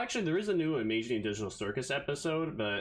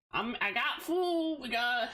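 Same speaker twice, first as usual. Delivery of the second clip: high, slow, gleeful announcement; mock excited; 10 semitones higher than usual. Streamer bragging to viewers about his food, high and gleeful. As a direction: show off the food like a treasure.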